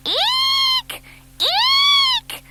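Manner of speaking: very high excited eek; baby owl squeaking over the boys' heads; owl shriek. A narrator's reading. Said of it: "heads crying".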